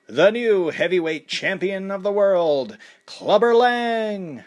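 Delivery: high, loud, long drawn-out call of the name; slow stretched announcer call; ring announcer. A narrator's reading. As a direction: boom it into the arena like a fight announcer.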